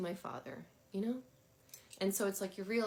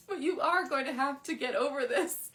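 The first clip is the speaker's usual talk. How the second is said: loud, high, through laughter; laughing mock verdict; 7 semitones higher, 6 dB louder than usual. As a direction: deliver the playful telling-off while cracking up. Voices card's verdict while laughing.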